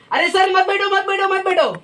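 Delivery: high-pitched, repeated mock plea; mock-alarmed cry to stop; panicked warning. Shown as a demonstration. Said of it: "तो बोला".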